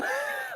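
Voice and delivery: a soft, high laugh that tips into a cry; high laughing sob. Real, not acted. Telling her friend about the anger, a soft high laugh close to crying. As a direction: let the laugh crack. Laughs after hate line.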